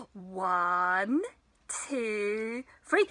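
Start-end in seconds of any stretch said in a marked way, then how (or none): none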